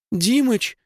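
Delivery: high, gasping cry of the name; startled gasp. Acted. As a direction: gasp it out in shock. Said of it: "охнула Даша".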